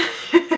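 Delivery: short bright giggle; a sneaky little giggle. Real, not acted. Giggling at plotting secret.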